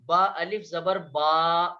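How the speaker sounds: drawn-out Arabic long a; long vowel baa. Shown as a demonstration. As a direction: stretch the با long and slow. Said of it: "یہ long واویلز ہوا".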